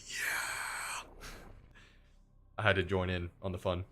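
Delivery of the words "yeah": hushed, voiceless mock yell; retold scream. Streamer replaying their video scream, mock yell under the breath. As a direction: imitate a scream without the volume. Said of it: "I was screaming myself".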